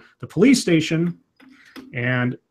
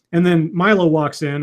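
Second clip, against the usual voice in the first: ordinary talk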